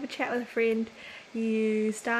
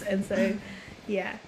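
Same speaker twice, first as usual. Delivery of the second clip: ordinary talk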